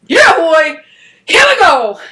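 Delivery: hyped cheer. Retold or imitated. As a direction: pump up the crowd with a yell. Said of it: "And I'm like".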